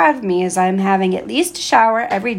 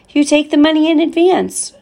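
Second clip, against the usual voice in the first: surprised protest; 8 semitones higher, 6 dB louder than usual. Acted. Boy protests to hotel owner Murat.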